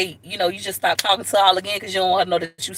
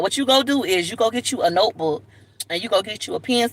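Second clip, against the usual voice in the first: usual speaking voice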